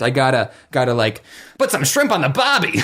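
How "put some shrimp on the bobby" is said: a light mock Australian accent; a hint of Aussie, tossed off for a laugh. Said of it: "prep up my Australian accent".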